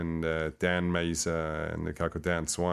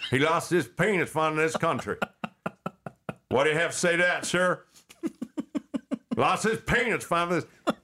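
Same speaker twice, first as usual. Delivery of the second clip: higher, pushier put-on voice, repeating; hammed-up pleading character; 8 semitones higher than usual. Billy grilling Bernie Sanders, higher hammed-up put-on voice. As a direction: press the senator with mock outrage. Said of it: "I said".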